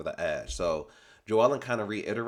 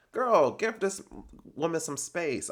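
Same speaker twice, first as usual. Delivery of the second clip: exasperated; 8 semitones higher than usual. Real, not acted. Exasperated call-out.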